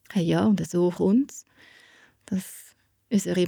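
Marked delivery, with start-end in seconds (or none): none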